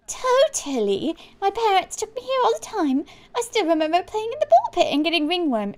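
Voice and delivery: high, hammed-up reminiscing; gushing youngster. Customer recalling the ball pit, high and gushing. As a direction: play an over-keen youngster. Voices a game teenager.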